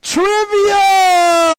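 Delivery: loud, high, excited announcer call; game show announcement. Acted.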